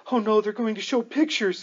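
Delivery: put-on a child blurting out in alarm; little girl exclaiming. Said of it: "exclaims a little girl".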